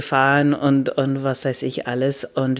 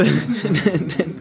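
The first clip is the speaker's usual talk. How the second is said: short laugh; 4 semitones higher than usual. Real, not acted. Amused by hard name.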